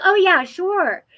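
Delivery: hammed-up eager yes; eager mom.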